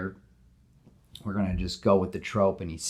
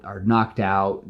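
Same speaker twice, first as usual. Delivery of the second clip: normal talking voice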